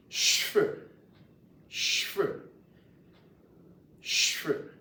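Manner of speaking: "cheveux" said slowly, repeated for learners; Louisiana French pronunciation. Shown as a demonstration. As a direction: sound out "cheveux" clearly for a class. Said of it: "We pronounce hair in French".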